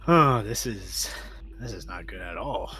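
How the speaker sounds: normal talking voice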